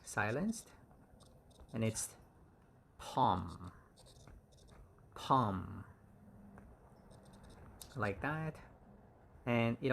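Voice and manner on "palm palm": "palm" said pahm with no L; "palm" with a silent L, clear teacher model. Shown as a demonstration. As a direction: drop the L in "palm". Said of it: "L is silenced".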